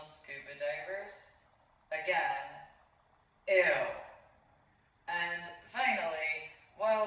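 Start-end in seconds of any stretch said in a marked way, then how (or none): 3.5-3.8 loud, recoiling ew; disgusted ew. Real, not acted. Disgusted at being compared.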